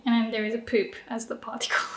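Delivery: quick whispered aside; whispered joke. Real, not acted. Teacher whispering a silly joke to students. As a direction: drop to a fast whisper for the joke. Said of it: "joking aside".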